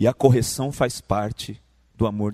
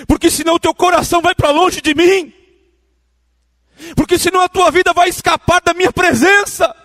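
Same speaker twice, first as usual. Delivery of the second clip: high, loud, sing-song preacher's cry; preaching at full force; 12 dB louder than usual. Acted. Pastor exhorting the congregation over music, fervent high loud preaching. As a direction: lift the whole church with a fervent, chanted appeal.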